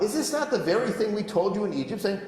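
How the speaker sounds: high, whining sarcasm; the people whining sarcastically at Moses, pitched high; sarcastic whining. Acted. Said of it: "so sarcastic".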